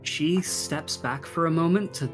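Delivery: normal talking voice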